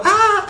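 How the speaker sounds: high yelp of fright; hammed-up high shriek; mock scream. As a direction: squeal like a startled clown. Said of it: "and I go".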